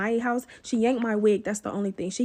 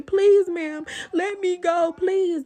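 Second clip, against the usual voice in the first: mock pleading scream; 6 semitones higher, 3 dB louder, much slower than usual. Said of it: "You could hear her screaming".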